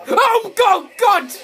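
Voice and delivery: high, loud shout of fright; scared shout. Real, not acted. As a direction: yell out in panic. Caught by the monster.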